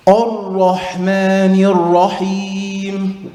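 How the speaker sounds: slow recitation of Ar-Rahmanir Rahim, the n joined onto Rahim; Ar-Rahmanir Rahim chanted with the linking mistake; common mistake again. Shown as a demonstration. Teacher repeats the wrong version for the class to spot. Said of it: "Where's the mistake?".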